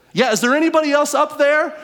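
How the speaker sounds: loud, high, fast hopeful call; man on the cliff asking for anyone else; calling out the punchline. Retold or imitated. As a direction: shout up the cliff for someone else. Man's punchline call upward.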